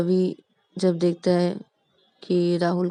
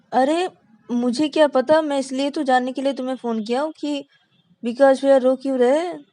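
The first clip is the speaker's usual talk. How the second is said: higher, louder, impatient; exasperated; 6 semitones higher, 6 dB louder, faster than usual. Acted. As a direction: throw up your hands, fed up. Rahul exasperated on the phone. (झल्लाकर ऊँची आवाज़ में)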